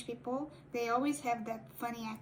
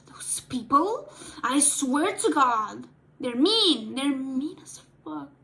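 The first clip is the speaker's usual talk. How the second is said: exasperated rant; 5 dB louder than usual. Real, not acted. Venting about the cosplay community.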